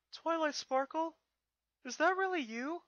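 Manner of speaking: put-on high, slow, wondering boy voice; can't believe his eyes; little dragon stunned to see his friend crowned; amazed young dragon. Spike sees princess Twilight.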